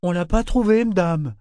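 high, loud, wide-eyed deckhand; simple-minded sailor. A narrator's reading. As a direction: pipe up high and artless. Clapeau la regarda avec des yeux ronds.

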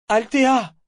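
loud, high-pitched yell of a name. A narrator's reading. Brachen calling Althea from the ship's rail, yelling. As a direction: howl it across the water. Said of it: "hurla-t-il".

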